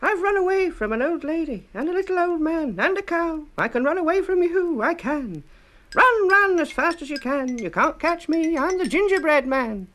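very high, loud, taunting little voice; high loud singsong taunt; gingerbread man. A narrator's reading. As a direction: tease the chasers from far ahead.

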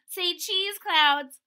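high, loud, playful call; chirpy little photographer; cheerful child voice. Acted.

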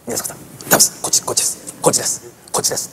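whispered urging; quietly steering a friend to the corner seat; hushed. Retold or imitated. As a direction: whisper them over to the side. Quoting whispered direction.

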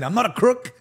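high-pitched presidential denial; Nixon impression.

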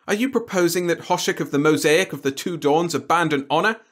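loud, indignant aristocrat; offended alien noble. A narrator's reading. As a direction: bristle at the insult. Hoshik, outraged.

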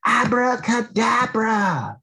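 cartoon raindrop casting a spell; Drop's magic voice. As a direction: announce the trick like a little magician. Water drop character doing magic.

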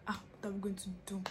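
quick, soft anxious whisper; worried whisper. Retold or imitated. Sister whispering back, worried.

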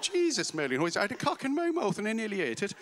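high, loud Kerry exclamation; Kerry accent. Retold or imitated. Irish mother exclaiming over her Christmas pudding, in a high Kerry accent. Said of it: "in my best Kerry accent".

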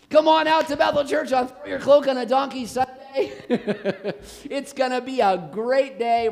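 loud, high, laughing invitation; mock church ad. Preacher jokingly advertises a donkey Sunday, laughing loud. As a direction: sell it like a giddy announcement. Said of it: "mock church announcement, laughing".

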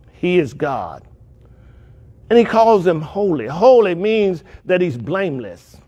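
ordinary talk

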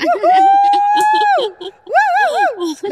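long, high, loud "woo"; excited whoop. Real, not acted. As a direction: let out two drawn-out, high-pitched cheers. Celebratory whooping in the chat. (صيحة فرح عالية وطويلة)

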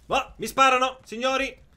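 shouting high and loud in alarm. Real, not acted. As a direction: yell it out, under fire. Getting shot at in game. (grido allarmato)

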